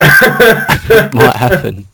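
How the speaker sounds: slow, laughing threat. Real, not acted. Co-host laughing as he warns he might win. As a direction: stretch it out through laughter.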